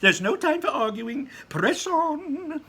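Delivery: high, loud, hurried showman; Mr. Wonka voice. Acted. Wonka hurrying the visitors along, high and loud. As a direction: urge them on in a high bright voice.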